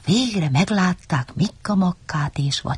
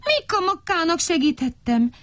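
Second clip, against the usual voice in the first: high-pitched plaintive defence; lightly hammed-up lament; whining; 13 semitones higher than usual. A narrator's reading. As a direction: whine your excuse in a tiny voice. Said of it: "siránkozott Vacska Matti".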